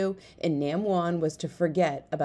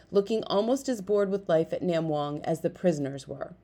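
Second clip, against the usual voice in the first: ordinary delivery, nothing marked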